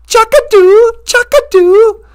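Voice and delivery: high, loud, coaxing sing-song; mock sing-song. Holly coaxes Chaka into a dangerous job with a mock sing-song. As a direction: wheedle like luring a pet. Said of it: "She goes".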